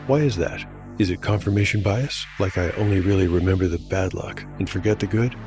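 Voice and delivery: ordinary delivery, nothing marked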